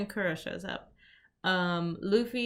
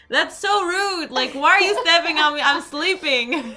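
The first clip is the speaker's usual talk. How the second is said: indignant Luffy; 8 semitones higher, 8 dB louder than usual. Retold or imitated. Luffy complaining to Nami for waking him, high whiny outrage. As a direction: play Luffy grumpy at being stepped on. Luffy woken by stepped-on face.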